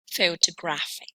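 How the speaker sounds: "Photographic" with main stress on GRAPH, lighter stress on PHO; stress on third syllable. Shown as a demonstration. Teacher modelling double stress, "Photographic" with primary stress on GRAPH. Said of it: "on the third one".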